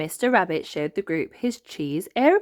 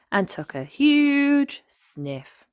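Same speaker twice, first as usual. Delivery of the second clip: slow dramatic narration; 10 semitones higher, 4 dB louder, much slower than usual. A narrator's reading.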